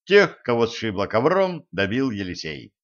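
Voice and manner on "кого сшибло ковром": usual speaking voice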